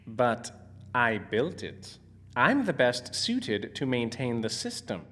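low, soft, hurt reply; quietly taken aback, voice sinking; wounded protest. Acted. Inventor hurt at losing sole control.

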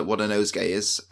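ordinary delivery

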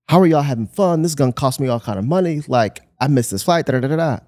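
indignant mock rant; retold upset outburst. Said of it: "getting more upset".

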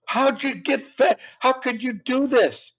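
high, slow, sing-song mock of the mother. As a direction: nag in a mother's high whiny tone. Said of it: "She, she would say".

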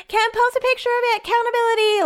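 very high squeaky put-on voice; mock group member shrieking accountability in a high voice. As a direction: squeak it like a cartoon scold.

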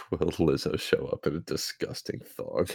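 laughing through the words; reading while cracking up. Real, not acted. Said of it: "that one got you".

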